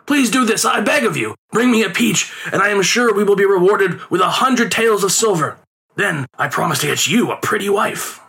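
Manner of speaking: high, coaxing father's plea; pleading. A narrator's reading. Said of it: "his father pleaded".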